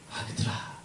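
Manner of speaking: put-on very soft, affectionate call to children; most loving. Shown as a demonstration. Father calling his children with love, tender and soft. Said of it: "가장 사랑을 담아서".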